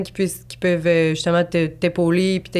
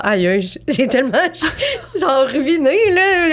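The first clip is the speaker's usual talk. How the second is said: laughing mock despair; 8 semitones higher, slower than usual. Retelling her panic after no reply.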